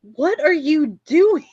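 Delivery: high, loud, slow horrified question; shocked shriek. Acted. Friend horrified at bridesmaid in the sink, loud shriek. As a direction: shriek in disgust.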